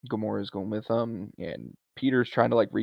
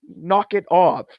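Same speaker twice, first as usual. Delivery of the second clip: loud, higher mock snap; sharp, raised-voice telling-off; annoyed retort; 8 semitones higher, 8 dB louder, slower than usual. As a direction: imitate someone fed up with being stared at. Retold annoyed retort to staring.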